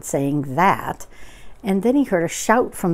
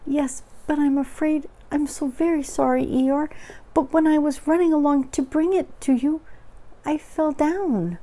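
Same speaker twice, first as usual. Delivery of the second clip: high little storybook voice, sorry; apologetic Piglet; 9 semitones higher than usual. Acted. Piglet confesses his fall to Eeyore, high and apologetic. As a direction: apologize in a small, high character voice.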